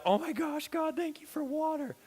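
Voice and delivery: high, gushing relief; overjoyed thanks. Retold or imitated. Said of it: "never been more thankful".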